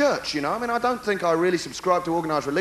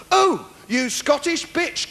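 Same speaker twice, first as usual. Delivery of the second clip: haughty giggle; 6 semitones higher, slower than usual. Pretend. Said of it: "giggled haughty".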